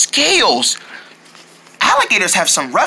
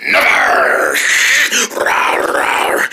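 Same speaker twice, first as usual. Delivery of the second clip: low, breathy beast voice; growly alligator; much slower than usual. Acted. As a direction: growl it like a monster.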